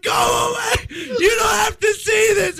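very high retold yell, held back in volume; mock yell. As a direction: imitate yelling her away, high-pitched. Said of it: "don't yell at me".

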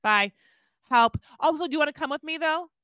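high, loud whiny wife done fast at first; playing Holly. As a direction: wail it like a homesick wife who has had enough. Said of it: "let me be the person opening the door and you be her".

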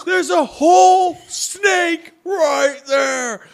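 high, loud, very slow insistence; insistent alarm. Retold or imitated. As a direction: drag it out to make him believe. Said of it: "He goes".

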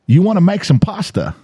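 high mock Australian accent; thick Australian accent. Host retelling his friend's puzzling question, thick Australian accent. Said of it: "his Australian accent is so thick".

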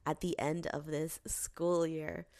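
ordinary delivery

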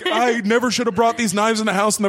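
quick, lightly hammed remorse; mock guilty father; imitating regretful dad. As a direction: rush the self-blame. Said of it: "you would expect him to be like".

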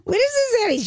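surprised. Real, not acted. Repeats in disbelief.

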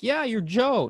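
high, offhand misremembering; careless rock star. Retold or imitated. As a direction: brush the fan off lightly. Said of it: "I wasn't just like".

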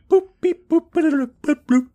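high, loud computer bleeps and boops; imitated tech beeps. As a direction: mimic generic sci-fi machine noises. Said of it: "generic technology sounds".

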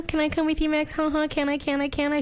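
put-on high, whiny kid voice; begging over and over like a brat; pestering child. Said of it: "Gee, Max".

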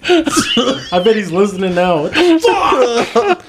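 high, crying with laughter, ending in a shout; real helpless laughter. Real, not acted. Friends cracking up over the nickname story, crying with laughter. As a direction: laugh so hard the words squeak out.

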